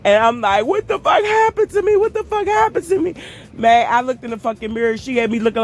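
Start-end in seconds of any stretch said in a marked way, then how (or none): 0.6-3.1 very high, wailing despair; distraught wail. Retold or imitated. Crying at her bruised face in the mirror, high wail. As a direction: cry it out at the mirror.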